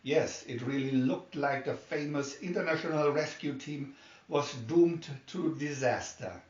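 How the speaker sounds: plain reading voice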